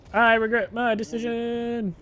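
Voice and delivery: high, drawn-out mock lament; mock regret. Player regretting the jump mid-game, high mock lament. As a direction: stretch it out high, in playful regret.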